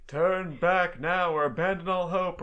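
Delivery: mock-ominous castle villain, stretched out; Dracula's warning. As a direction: intone it like a hammy Dracula warning. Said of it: "one with Dracula's castle, where he's like".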